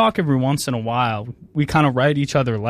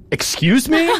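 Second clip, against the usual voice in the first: indignant surprise; 11 semitones higher, 3 dB louder than usual. Acted. Outraged at empty playlist.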